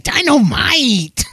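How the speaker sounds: stretched-out high Dynamite shout; slow, high-pitched Dynamite!; J J catchphrase. Retold or imitated. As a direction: belt it like the sitcom catchphrase. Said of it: "J J was like".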